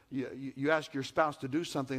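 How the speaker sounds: usual speaking voice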